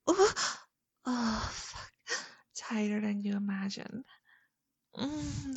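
loud, breathless and heated; passionate moaning. Acted. Climactic moaning roleplay.

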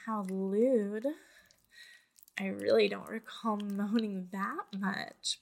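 ordinary talk, nothing marked

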